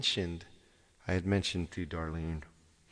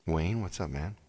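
usual speaking voice

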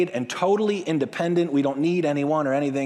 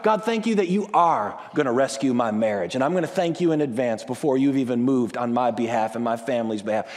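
lower, calm grateful prayer; confident thanks; 5 semitones lower than usual. Shown as a demonstration. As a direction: give thanks as if it is done. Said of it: "how about".

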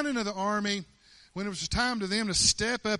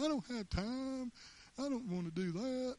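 mock whining; 6 semitones higher, 9 dB quieter than usual. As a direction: whine it like a reluctant kid making excuses. Mocks an excuse he could have made.

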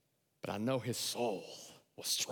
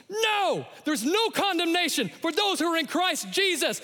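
high, loud shouted refusal; shouted scripture; triumphant shout; 8 dB louder than usual. Acted. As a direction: fire it back as a truth bomb. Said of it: "you get to say".

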